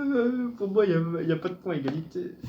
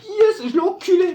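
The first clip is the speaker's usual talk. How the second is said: high, loud victory yell; triumphant cheer; 13 semitones higher, 6 dB louder than usual. Real, not acted. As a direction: shout it out, you just won. Celebrating a win.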